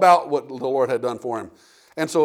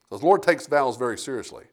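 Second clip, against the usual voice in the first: ordinary talk, nothing marked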